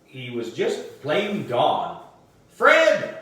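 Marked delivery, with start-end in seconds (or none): 2.5-3.2 loud, high call across the room; shouting a lost pet's name. A narrator's reading. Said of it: "I called out".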